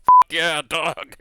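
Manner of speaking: loud, thrilled whoop; excited cheer. Retold or imitated. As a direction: cheer like a jubilant crew. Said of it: "They'd be like".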